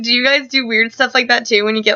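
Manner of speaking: usual speaking voice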